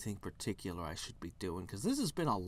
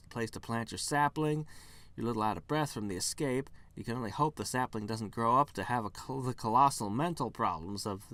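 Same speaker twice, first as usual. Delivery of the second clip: usual speaking voice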